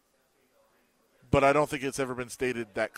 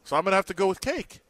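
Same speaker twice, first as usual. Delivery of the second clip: retold indignant complaint; 7 semitones higher, 4 dB louder than usual. Coach laments missed egg roll dessert.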